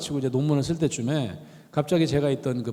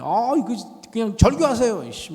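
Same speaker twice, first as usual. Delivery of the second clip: high, louder mock fury; retold angry advice; 7 semitones higher, 3 dB louder than usual. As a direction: snap it out as if taking his side. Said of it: "막 그런 거지".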